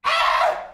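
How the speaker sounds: very high startled yelp; short shriek, pitch leaping up; sudden high scream. Real, not acted. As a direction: scream out in alarm.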